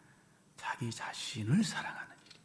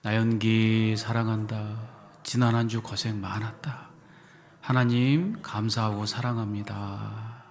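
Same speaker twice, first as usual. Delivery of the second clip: louder, slow and stretched; loud slow affirmation; 5 dB louder, much slower than usual. Shown as a demonstration. Eyes closed with the congregation, loud slow affirmation. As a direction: proclaim love to yourself, unhurried. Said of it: "자, 시작".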